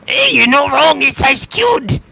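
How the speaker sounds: high, loud orc cackle-talk; gleeful orc voice. Acted. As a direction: gloat over the taste of blood. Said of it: "and goes".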